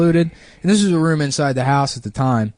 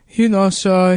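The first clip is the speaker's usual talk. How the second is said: put-on higher, hesitant Mexican-accented voice; 7 semitones higher, 4 dB louder, slower than usual. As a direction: imitate a halting Mexican landlord. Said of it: "this Mexican dude's like".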